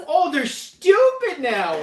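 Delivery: high, loud, drawn-out indignation with a laugh; mock offense. Host teasing co-host who insulted the boxes, mock offense. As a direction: stretch it out in playful outrage.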